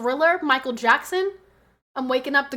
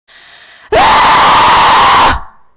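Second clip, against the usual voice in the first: long, high full-volume scream; piercing scream; 18 dB louder than usual. Real, not acted. Streamer answers a chat about screaming, deafening shriek. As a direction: scream at the top of your voice, held long.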